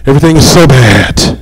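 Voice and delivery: mildly hammed-up woe; mock misery. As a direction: moan as someone empty inside. Acts out an empty, miserable person.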